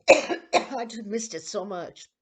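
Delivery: speaking through coughs; choking. Real, not acted. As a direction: choke out the words between coughs. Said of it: "That's why I'm choking".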